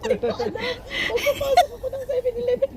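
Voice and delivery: giggling through the story; laughing hard while talking. Real, not acted. Laughing while telling frozen-nose story.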